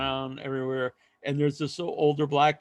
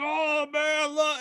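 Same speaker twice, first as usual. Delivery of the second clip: excited fan; 11 semitones higher, much slower than usual. Retold or imitated. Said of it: "he's like".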